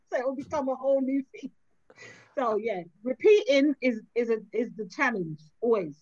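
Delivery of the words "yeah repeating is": ordinary talk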